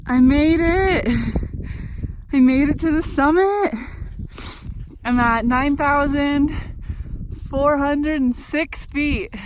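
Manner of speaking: loud, high summit announcement; triumphant. Real, not acted. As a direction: call out your victory from the mountaintop. Reaching the summit alone.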